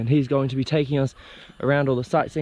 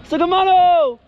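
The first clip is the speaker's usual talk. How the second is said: high, loud hello called out; cheery loud greeting; 5 dB louder, much slower than usual. Real, not acted. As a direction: call out a bright hello. Greeting locals loudly.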